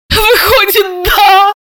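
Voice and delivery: high, tearful cry. A narrator's reading. Sister bursting into tears at the news, high and tearful. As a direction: wail it in tears. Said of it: "заплакала Серафима".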